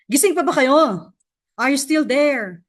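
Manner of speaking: calling out to viewers. Real, not acted. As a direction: wake them up with a cheery shout-out.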